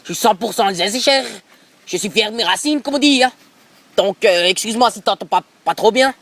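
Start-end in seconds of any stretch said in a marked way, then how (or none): 0.0-3.3 French with an Alsatian accent; thick Alsatian-accented French. Real, not acted. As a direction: speak French like a proud Alsatian. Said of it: "j'ai un accent un peu d'Alsacien".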